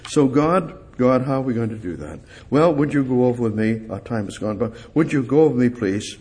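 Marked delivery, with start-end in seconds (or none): none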